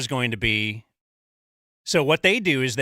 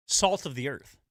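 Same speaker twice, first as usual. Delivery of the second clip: sarcastic; 4 dB quieter than usual. Real, not acted. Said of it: "I sense sarcasm".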